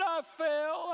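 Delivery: put-on high, tearful small-child voice; crying little boy. Small son tells dad about his bike fall, crying. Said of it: "and he cried".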